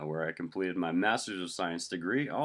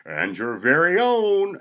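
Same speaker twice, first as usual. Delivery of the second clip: very loud, high, drawn-out build; announcer voice; 11 semitones higher, 12 dB louder, slower than usual. Acted. Announcer introduces the home team, announcer voice. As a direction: hold the last word like an arena announcer. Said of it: "it's been my intro".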